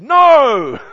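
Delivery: a sudden loud, high "No!" shouted back; retold shout. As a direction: bark out a flat refusal. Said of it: "he just shouted at me".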